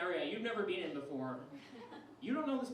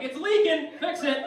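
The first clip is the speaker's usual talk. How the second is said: high, loud mechanic-shop demand; angry dad; 6 semitones higher, 9 dB louder than usual. Acted. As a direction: bark it like dropping off a car. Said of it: "like he was bringing an old beater".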